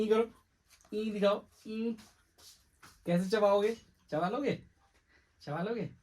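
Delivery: high playful coaxing voice; high baby talk. Acted. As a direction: coax the baby to show teeth. Baby talk about teeth.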